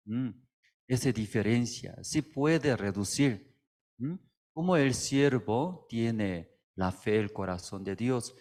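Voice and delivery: ordinary delivery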